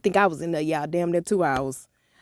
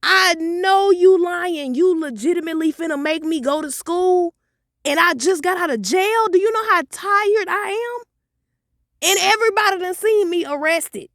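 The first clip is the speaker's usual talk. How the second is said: high, louder whiny protest; outraged teen; 10 semitones higher, 4 dB louder than usual. Retold or imitated. Daughter protesting to mom, high whiny outrage. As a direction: squeal it, indignant and tired. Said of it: "I was like".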